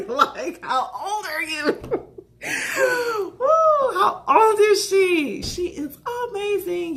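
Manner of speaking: high, loud, half-crying squeals of awe; squealing awe. Real, not acted. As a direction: gush like a starstruck fan. Gushing over Angela Bassett.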